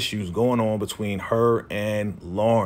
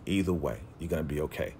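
much softer and lower, gently reassuring; quiet reassurance; 8 semitones lower, 11 dB quieter, faster than usual. Retold or imitated. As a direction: ease her mind, barely above a murmur. Friend reassuring Issa, repeated.

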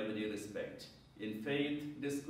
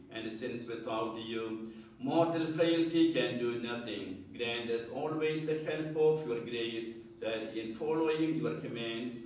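raised, solemn prayer voice; liturgical prayer; 4 dB louder than usual. Real, not acted. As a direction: pray it aloud to the congregation. Priest's opening collect prayer.

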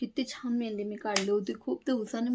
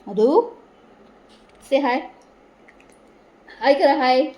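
loud, raised coaxing call; 3 semitones higher, 11 dB louder, slower than usual. Real, not acted. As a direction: call out to get a little one to say hi. Calls child to say hi.

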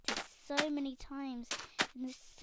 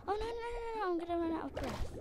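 panicked; 6 semitones higher, much faster than usual. Real, not acted. Running out of air underwater.

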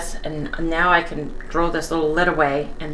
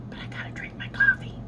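whispered sign-off; 6 dB quieter, faster than usual. Real, not acted. Signing off to drink coffee.

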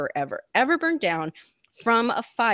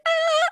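retold scream; 3 dB louder than usual. Screaming at the 9/11 news.

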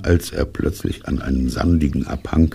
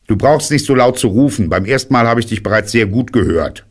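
high, scolding little rabbit; voice from the hole; 7 semitones higher, 3 dB louder, faster than usual. A narrator's reading. Hidden rabbit tells caller off for shouting, high voice. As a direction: complain in a high character voice. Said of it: "dann fügte die Stimme hinzu".

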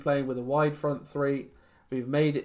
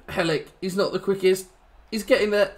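higher, louder urging on a runner; willing him on, voice rising; excited urging; 6 semitones higher, 3 dB louder than usual. Real, not acted. Urging on a player running.

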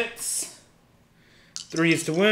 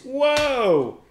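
surprised whoa; 8 semitones higher than usual. Real, not acted. Big dice result.

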